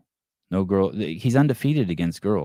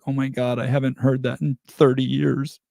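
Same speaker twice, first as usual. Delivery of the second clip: lightly voiced old woman, pitched up; higher-pitched mock reaction of an older woman; old woman's exclamation; 6 semitones higher than usual. Host quoting old woman's reaction to crude compliment, lightly imitated. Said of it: "she was like".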